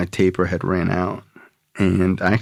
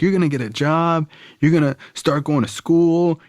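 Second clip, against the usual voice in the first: lecturing dad; 7 semitones higher than usual. Retold or imitated. Angry dad voiced.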